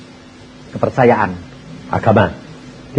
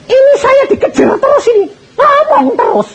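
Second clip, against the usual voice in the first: high, half-laughing exasperation; laughing complaint. Acted. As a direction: laugh it off in disbelief.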